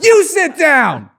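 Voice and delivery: high, drawn-out mimicked scream; slow, full-blast yelled command, mimicking; imitated yell. Imitating a friend's outburst at a dance recital, yelling. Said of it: "Somebody has clipped [name] yelling".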